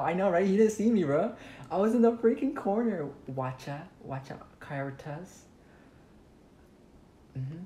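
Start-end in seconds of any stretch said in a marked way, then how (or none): 0.0-3.1 high loud gleeful bragging; excited. Real, not acted. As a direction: shout it out, thrilled. Excited after juking killer.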